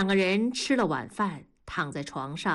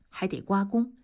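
ordinary delivery

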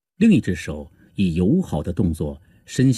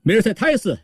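high-pitched joyful call; 欢快呼喊; 4 dB louder than usual. Acted. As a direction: call out the name happily, voice leaping high. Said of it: "欢快地大声叫道". (欢快地高声呼喊)

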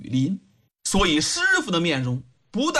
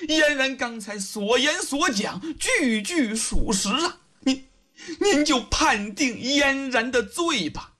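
plaintive pleading; 5 semitones higher than usual. A narrator's reading. Young woman begs the judge to convict her, plaintively. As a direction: plead sorrowfully, stretching the phrase ends. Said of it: "哀哀地对狄仁杰说".

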